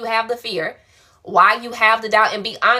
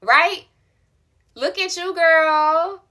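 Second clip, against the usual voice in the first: excited praise; 9 semitones higher, 4 dB louder than usual. Real, not acted. Praising viewer's success.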